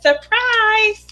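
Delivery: high, sing-out announcement; spring a surprise on a friend; playful surprise reveal. Retold or imitated.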